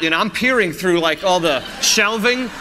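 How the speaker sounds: ordinary delivery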